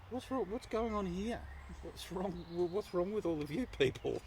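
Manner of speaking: high-pitched imitation of a baffled friend; puzzled boy. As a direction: ask it in a high, bewildered kid voice. Oblivious friend asks, retold.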